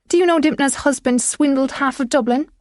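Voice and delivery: high-pitched, heated tirade; screamed accusation. A narrator's reading. Widow denouncing a rival's husband to the inspector, screaming. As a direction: keep the outburst going, pitched high. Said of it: "Roisin screamed".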